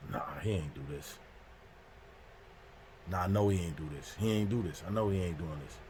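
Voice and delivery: low, hushed muttering to himself; quiet disbelief. Retold or imitated. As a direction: say it very softly and low, refusing to believe it. Standing in dark, muttering in disbelief.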